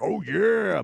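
loud high "Oh, yeah" burst; yelling greeter. Retold or imitated. Greeter barging in on two young guys with a yell. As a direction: bursts in yelling like Kool-Aid. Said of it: "busts in and yells".

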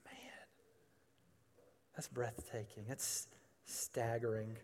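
very soft, awestruck aside; quietly staggered, with pauses to take it in; hushed awe. Real, not acted. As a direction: drop almost to a murmur in wonder. Awed at what he just read.

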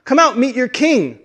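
high, urgent summoning call. Acted. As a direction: call the people out to meet their king. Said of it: "almost like calling out".

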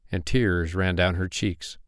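ordinary delivery

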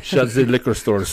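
put-on jokey German accent, th turned to z; mock German accent. Comic retelling of Hitler's rise, mock German accent.

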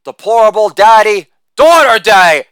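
normal talking voice

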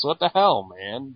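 mock offended heroes; hammed-up Justice League complaint; indignant protest. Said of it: "they're all like".

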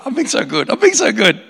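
words broken up by real giggles; laughing. Real, not acted. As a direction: laugh through it, can't keep a straight face. Giggling mid-story aside.

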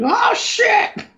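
freaked-out squeak; high-pitched panicked yelp. Retold or imitated. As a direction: squeal it in shock. Voicing Pidge freaking out.

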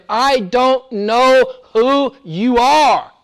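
lightly voiced Jesus turning them away; a hint of a stern judge; Jesus rejecting. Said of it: "what will Jesus say to them?".

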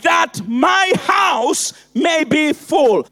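full-force preaching. Acted. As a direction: hammer each line home. Building sermon refrain, shouting.

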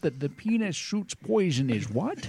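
high, slow put-on Scottish voice; Sean Connery impression. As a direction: ham up a Connery voice. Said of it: "all these impressions".

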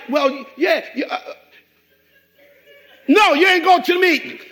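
a loud, firm no to the son; firm shout. Retold or imitated. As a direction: shout it down, end of discussion. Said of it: "I'm like".

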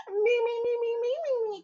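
high mocking singsong; high mock parroting. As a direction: squeak it back at him. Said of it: "air quote things".

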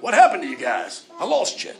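mock confused God. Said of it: "He's not like".

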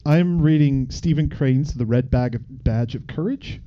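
normal talking voice

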